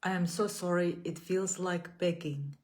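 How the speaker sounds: measured, gentle affirmations with long pauses between. Acted. Leads repeat-after-me affirmations.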